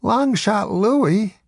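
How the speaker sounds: Long Shot Louie drawn out slowly, high and rising; stunned, stretching out the name; incredulous question. A narrator's reading. Narrator repeats name, incredulous.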